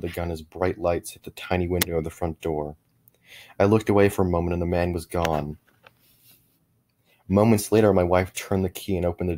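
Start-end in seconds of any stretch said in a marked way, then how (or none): none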